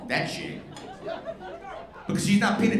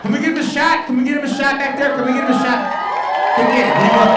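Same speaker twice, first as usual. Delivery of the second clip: loud calls for a round of shots; calling out to the bar; 5 semitones higher, 7 dB louder, faster than usual. Real, not acted. Comedian calling loudly to the bar staff for shots. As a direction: shout your order across the bar.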